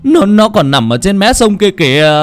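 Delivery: high, loud, stammering wail of fear; terrified insistence. Acted. Frightened fisherman insists to Tư he saw it, terrified wailing. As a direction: insist desperately, pitch climbing into a wail.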